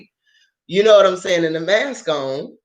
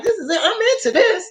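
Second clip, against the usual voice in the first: high and laughing, totally into it; laughing delight; 11 semitones higher, faster than usual. Retold or imitated. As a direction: squeal it through a laugh. Loving the goth booths.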